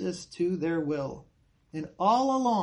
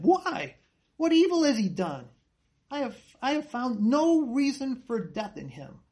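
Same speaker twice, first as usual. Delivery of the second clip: higher, louder pleading Why; pleading protest; 5 semitones higher, 3 dB louder than usual. Retold or imitated. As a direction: plead with the crowd for Jesus. Pilate pleads with crowd.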